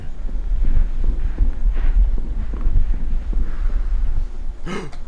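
startled high gasp; sharp gasp. Real, not acted. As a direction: gasp in sudden fright. Nearly falls off the map.

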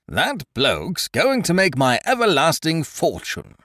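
a jolly bluff chap chattering on; hearty Ukridge. A narrator's reading. Ukridge telling his friend to remember a tune, hearty.

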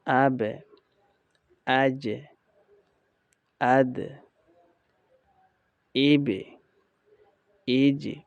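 আবে, আজে, আদ, এবে, এজে with echoed endings falling away; downward articulation. Shown as a demonstration. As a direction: bounce each last consonant of আবে to এজে downward. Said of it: "অর্থাৎ নিচের দিকে চলে আসছে".

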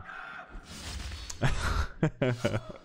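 long, unvoiced chuckle; real amused laugh. Real, not acted. Streamer amused by a chat comment about accents, laughing. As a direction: laugh it out at length, mostly breath.

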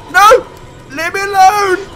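high, loud plea to be left alone; shouted protest. Real, not acted. Player fends off game attacker, loud protest. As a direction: yell them away, pitch up.

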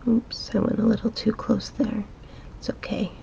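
ordinary talk

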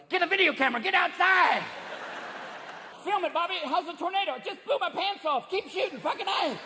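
high, urgent hollering; hammed-up high excited yokel; excited storm filmers. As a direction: call out in thrilled mock panic. Acted thrill-seekers filming a tornado.

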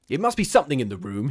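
loud, sharp snap; snapping like a lash. A narrator's reading. Henry refusing to believe in the shadow, snapping. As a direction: crack it out like a whip. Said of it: "a voice which seemed to snap like a lash".

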